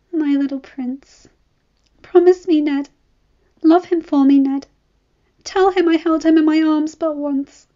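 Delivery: put-on dying mother pleading with Ned for her baby, high; dying young mother. A narrator's reading. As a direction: beg Ned with your last breath. Dying mother's last words.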